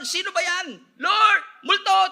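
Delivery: loud, high-pitched alarm; panicked cry. Acted. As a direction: cry out as if a ghost is coming at you. Acting out the frightened disciples.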